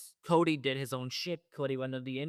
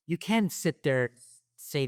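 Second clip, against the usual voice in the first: angry outburst; 2 semitones higher, 7 dB louder, slower than usual. Real, not acted. Angry rant against Paul's comments.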